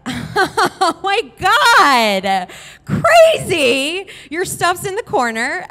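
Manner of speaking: loud, high, stretched mock gush; fake friendly amazement. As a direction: cover the shock with hammed-up cheer. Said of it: "real friendly".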